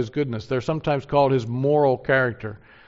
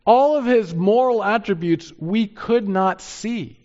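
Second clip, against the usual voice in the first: higher, louder, ringing proclamation; full-force preaching; 8 semitones higher, 5 dB louder than usual. Real, not acted. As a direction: build the sermon to a passionate peak.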